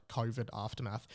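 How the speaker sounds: ordinary talk, nothing marked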